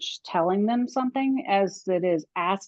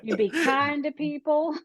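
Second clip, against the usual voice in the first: put-on Southern accent; 8 semitones higher than usual.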